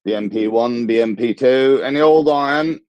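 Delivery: rag-and-bone man's call in a bad cockney; hammed-up cockney street call, rising high.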